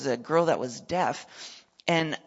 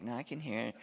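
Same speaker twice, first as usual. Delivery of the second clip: much softer, firm refusal; quiet girl's voice; 5 semitones lower, 10 dB quieter, faster than usual. Retold or imitated. Girl turning down a second prayer, much softer. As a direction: keep it small but final.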